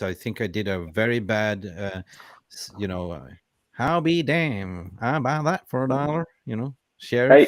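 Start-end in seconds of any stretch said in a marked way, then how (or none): 3.7-7.5 mock Southern Texan cowboy; Texan accent. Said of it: "better at them accents than your own".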